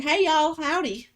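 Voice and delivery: high, loud hammed-up Southern howdy; mock Southern greeting. Mocking how locals greet a newcomer, hammed-up Southern. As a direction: play up a country drawl greeting. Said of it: "we're out here like".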